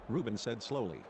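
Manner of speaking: ordinary delivery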